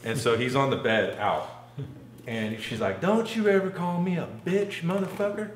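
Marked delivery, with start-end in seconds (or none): 3.0-5.5 high-pitched imitation of a Southern woman; mock a Southern woman telling him off; Southern accent. Storyteller as the woman who just knocked him out, Southern accent. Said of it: "they both had Southern accents".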